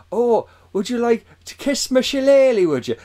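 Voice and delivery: loud, high-pitched Irish caricature; badly faked Irish accent, teasing; mock Irish accent. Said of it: "further away than we are to Ireland".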